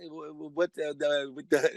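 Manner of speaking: low, soft stuttering in broken English; flustered stammering. Retold or imitated. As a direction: trip over the opening words, lost for English. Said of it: "I'm not speaking English good now".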